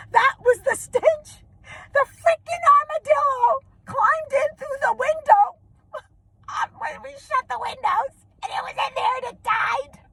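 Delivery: very high, squealing through laughter; high, wheezy hilarity; cracking up. Real, not acted. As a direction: barely get the words out for laughing. Cracking up at the punchline.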